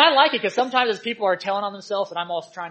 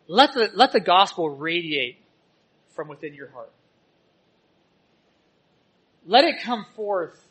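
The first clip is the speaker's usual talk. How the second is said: measured preaching; 2 semitones lower, 3 dB louder than usual. Acted. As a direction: let each line land on the church. Sermon exhortation with long pauses.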